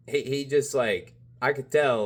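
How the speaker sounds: normal talking voice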